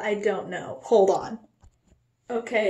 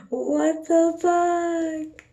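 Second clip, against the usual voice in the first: mild panic; 7 semitones higher, much slower than usual. Real, not acted. Panicking at shaking hands.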